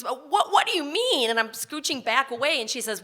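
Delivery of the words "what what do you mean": higher, startled what; shocked disbelief. Retold or imitated. Patient stunned by nurse's question, pitched high.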